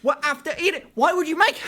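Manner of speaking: high, incredulous questioning; worked-up, high-pitched disbelief; exasperated rant. Real, not acted. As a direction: complain in baffled outrage. Ranting about eating on beds.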